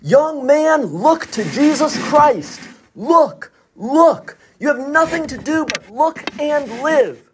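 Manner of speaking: high ringing call, slowed on "look to Jesus Christ"; preacher's shout. Retold or imitated. Primitive Methodist preacher calling to young man, ringing shout. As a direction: lift your arms and cry out each "look". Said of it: "he shouted".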